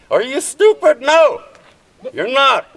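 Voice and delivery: high, loud and outraged; furious shout. Real, not acted. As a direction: yell the question straight at them. Angry protest at officials.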